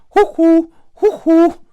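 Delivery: very high, loud two-note hoots; piercing cuckoo call; cuckoo clock. Acted. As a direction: hoot like a cuckoo popping from a clock.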